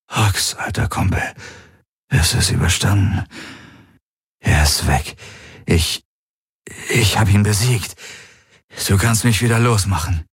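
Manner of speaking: worn-out, raw-throated voice; sore voice. A narrator's reading. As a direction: speak haltingly through a sore throat after an ordeal. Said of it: "sagte er mit Wunderstimme".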